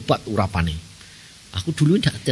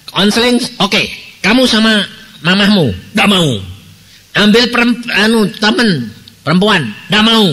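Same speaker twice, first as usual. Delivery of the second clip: louder, higher questioning; pressing questions; 7 semitones higher, 3 dB louder than usual. Retold or imitated. Pastor questioning the young woman, louder and higher. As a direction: press her with each question. Questioning girl who refuses.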